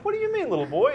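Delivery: high, wide-eyed asking back; mock high puzzled voice. Host retells answering the kid, high and puzzled. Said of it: "And I said".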